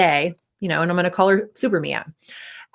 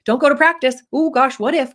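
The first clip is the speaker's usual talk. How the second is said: higher, louder worried inner voice; mock anxious brain; 6 semitones higher, 3 dB louder than usual. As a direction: act out a mildly panicked what-if.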